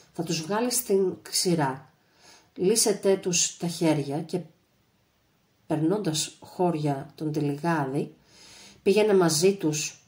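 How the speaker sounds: usual speaking voice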